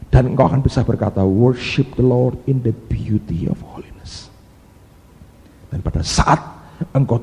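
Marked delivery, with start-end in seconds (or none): 1.3-4.3 solemn scripture reading. Acted. Scripture quoted in English.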